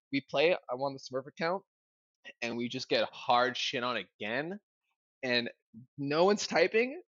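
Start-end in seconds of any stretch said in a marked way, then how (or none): none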